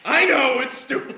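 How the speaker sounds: high, loud mock-exasperated cry; hammed-up defensive yell; defensive outburst. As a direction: play an embarrassed hero shouting back. Hero's retort to smirking teammates.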